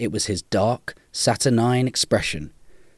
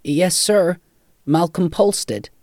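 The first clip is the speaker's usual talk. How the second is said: higher-pitched, dutiful answer; deferential, pitched-up voice; polite young Malcolm; 8 semitones higher, 3 dB louder, slower than usual. A narrator's reading. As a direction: answer respectfully in a raised young voice.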